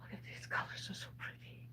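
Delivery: soft unvoiced whisper. Real, not acted. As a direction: hush it, admiring. Whispering while admiring candy.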